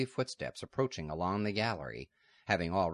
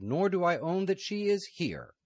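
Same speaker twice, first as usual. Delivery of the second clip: much higher, louder refusal; defiant abbess; 12 semitones higher, 5 dB louder than usual. Acted. Abbess refusing the leader, high and defiant. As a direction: refuse him with cold defiance.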